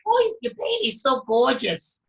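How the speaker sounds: high, slow gushing Australian voice; imitated Australian accent. Australians admiring his baby, high drawn-out Australian accent. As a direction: coo it in a put-on Aussie accent. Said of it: "they're all saying to me".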